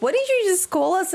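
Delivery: mock-affronted question, pitched high; imitating taken-aback parents. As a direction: play startled parents questioning their child. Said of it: "they both looked at me and they're like".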